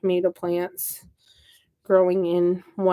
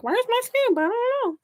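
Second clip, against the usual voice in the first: high, far-higher squeaky mock panic; mock helpless wail; 13 semitones higher than usual. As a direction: wail it high, hamming up the confusion. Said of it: "and I'm like".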